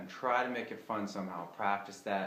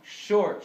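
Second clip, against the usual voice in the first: "short" punched much higher and louder; accented short; 11 semitones higher, 9 dB louder than usual. Shown as a demonstration. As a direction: hit "short" hard and high. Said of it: "I'm now accenting the first short note".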